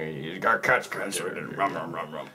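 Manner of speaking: low, soft, mumbling teacher imitation; mocking the teacher's voice. Friends make fun of their teacher's voice, low mumbled imitation. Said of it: "make fun of his voice".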